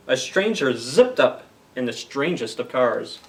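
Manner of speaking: lively picture-book narration; storybook reading. Acted. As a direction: play up the rhyme for the kids. Reads Dr. Seuss aloud to kids.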